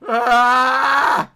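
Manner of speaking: loud high scream as he falls; screaming while falling. Real, not acted. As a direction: yell out in pain from the fall. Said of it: "Did that hurt?".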